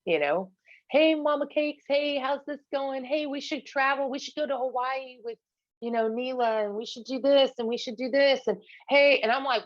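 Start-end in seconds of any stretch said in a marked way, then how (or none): 0.9-9.2 higher, sugary imitation of a charming ex; butter her up in a fake-friendly voice; mock sweet ex. Ex suddenly sweet-talking after her fight, mock sugary higher voice. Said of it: "suddenly he was super nice".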